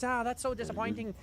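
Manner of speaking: high voice for the Irish host, mildly let down; lightly voiced Irish interviewer sighing; imitating the Irishman. The Irish interviewer on the press call, high and mildly disappointed. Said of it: "the Irishman goes".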